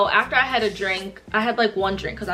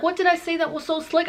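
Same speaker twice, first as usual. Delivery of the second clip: higher-pitched, baffled retold question; bewildered; 8 semitones higher than usual. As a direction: imitate being completely lost. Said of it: "I'm, like, really lost".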